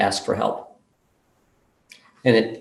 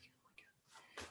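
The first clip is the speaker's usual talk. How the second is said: stunned whisper; 38 dB quieter than usual. Retold or imitated. Sudden realization, hushed.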